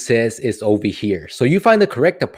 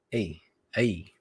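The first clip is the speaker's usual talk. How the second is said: ordinary delivery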